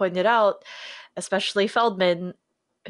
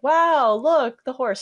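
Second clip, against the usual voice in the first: higher, louder mock wonder; amazed cry; 6 semitones higher, 6 dB louder, slower than usual. Onlookers as the glowing horse appears, amazed. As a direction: act out a burst of amazement.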